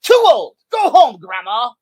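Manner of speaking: put-on loud, high, dismissive German accent; bad German accent. Coach rejecting Lisa in a loud German accent. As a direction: bark it in a hammed-up German accent. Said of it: "do a bad German access, accent".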